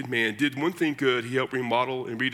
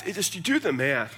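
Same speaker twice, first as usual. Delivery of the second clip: usual speaking voice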